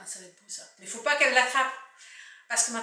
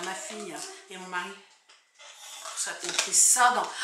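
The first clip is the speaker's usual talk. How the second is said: ordinary talk, nothing marked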